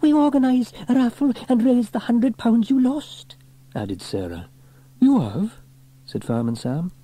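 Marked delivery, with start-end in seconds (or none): 0.0-3.4 put-on high, loud little girl's voice; excited child. A narrator's reading. Sarah telling her uncle about the raffle, excited. 5.0-5.6 high, loud startled question; surprised. A narrator's reading. Sam hearing the twins' raffle news, surprised.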